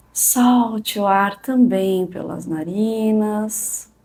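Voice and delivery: calm and unhurried, long pauses; soothing spa whisper. Acted. Leads a guided meditation.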